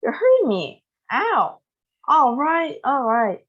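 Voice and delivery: high, strained pleading; pained complaint. A narrator's reading. Grandma begging the grandpa to let go. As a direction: give in under a twisted arm. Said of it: "cried Grandma Georgina".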